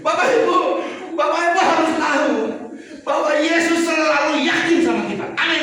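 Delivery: high, loud proclamation to the congregation; preaching at full force. Acted. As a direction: proclaim it with conviction. (seruan khotbah keras dan tinggi)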